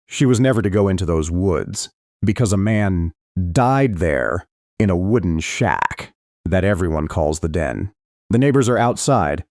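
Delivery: ordinary delivery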